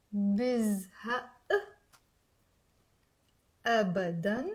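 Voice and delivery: أنا ما بزهق said word by word with long pauses; very slowly. Shown as a demonstration. Teacher reading the sentence very slowly for students. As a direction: drag each word out slowly for learners. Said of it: "I will say very slowly".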